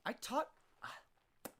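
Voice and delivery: talking while licking fingers. Real, not acted. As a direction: fingers in mouth, words slurred. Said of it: "sorry, I'm licking my fingers".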